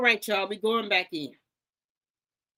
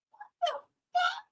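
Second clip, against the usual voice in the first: very high squeaky disbelief; stunned. Real, not acted. Reacting to the lie, a high stunned squeal.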